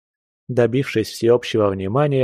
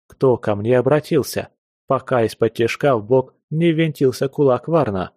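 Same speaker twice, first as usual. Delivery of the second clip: plain reading voice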